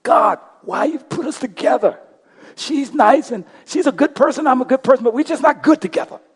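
high, loud anguished cry at God; retold scream at God. Man alone in his hotel shower, yelling at God about his marriage, high and loud. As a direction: cry out to God, pleading and frustrated. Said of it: "screaming at God".

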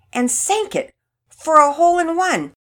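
high, loud climactic storytelling; excited narration. A narrator's reading. Narrator climaxes the winning shot.

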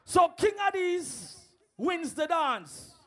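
slow announcement. Real, not acted. Announcing the clash winner.

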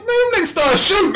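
high laughing excuse; cousin's excuse. Retold or imitated. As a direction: tell it like it's no big deal. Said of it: "He's like".

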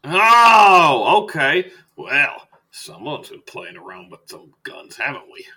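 boom, then drop into mock scolding; teasing doctor. Doctor to patient with a bullet graze, teasing. Doctor reacts to gunshot wound.

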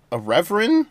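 ordinary delivery

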